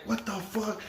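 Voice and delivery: hushed, stunned disbelief; shocked. Retold or imitated. Resident standing in the middle, shocked and hushed. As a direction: say it under your breath, dumbstruck. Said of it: "I go literally shocked".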